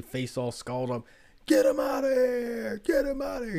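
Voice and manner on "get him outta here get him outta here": high, loud screaming of the same order twice; furious shout. Retold or imitated. Irate restaurant patron yelling to have protesters removed, screamed. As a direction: bellow it like an enraged diner at protesters. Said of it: "screaming out the top of his lungs".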